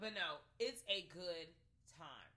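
usual speaking voice

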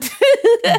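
a sudden high shriek of a laugh; high burst of laughter. Real, not acted. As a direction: crack up high and loud.

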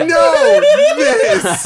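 high squeaky laughing no; laughing squeal. Retold or imitated. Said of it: "I was like".

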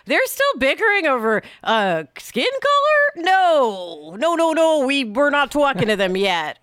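lightly voiced aliens, amused and dismissive; mock alien onlookers. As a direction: play the aliens shaking their heads at us, lightly. Voicing aliens flying over us.

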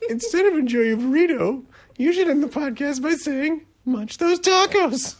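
high, loud teasing friend voice; imitating Liddy. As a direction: play the pushy friend, laughing. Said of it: "Liddy was like".

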